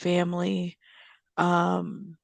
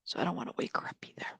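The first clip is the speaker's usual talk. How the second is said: whispering; 10 dB quieter, much faster than usual. Real, not acted. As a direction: whisper quickly, softly, so nothing wakes. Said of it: "Baby Bunny's napping right now".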